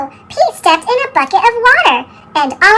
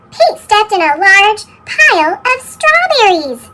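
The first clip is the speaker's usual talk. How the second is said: plain reading voice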